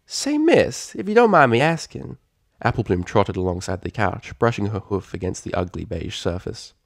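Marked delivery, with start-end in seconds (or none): 0.1-2.2 high, sweet filly voice; polite filly. Acted. Applebloom asking the mare, high filly voice. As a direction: ask politely like a curious little pony.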